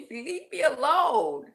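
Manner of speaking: laughing, drawn-out high mock plea; mock pleading. Mock plea to a fruit fly.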